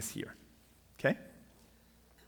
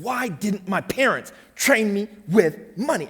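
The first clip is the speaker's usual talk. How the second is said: slow, raised-voice lament "Why didn't my parents train me with money"; retold upset; 7 semitones higher, 7 dB louder, slower than usual. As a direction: cry out the old frustration, drawn out. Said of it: "I'm fuming".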